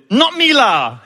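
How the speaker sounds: high, loud imitation of a protesting mother; comic high-pitched outburst, playfully imitated; mock indignant denial. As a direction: protest innocence in hammed-up Singlish. Acting out mom's indignant Singlish denial.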